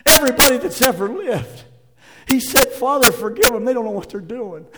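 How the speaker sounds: loud, high, driving sermon delivery; full-force preaching. Acted. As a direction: preach it out to the whole room.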